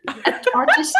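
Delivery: high laugh over stumble. Real, not acted. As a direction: giggle through the word.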